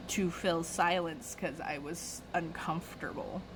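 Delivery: ordinary delivery, nothing marked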